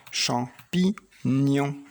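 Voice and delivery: champignon drawn out very slowly; slow syllable drill. Shown as a demonstration. Teacher to child, very slow champignon. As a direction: stretch every syllable of champignon. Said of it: "Ici, c'est le mot".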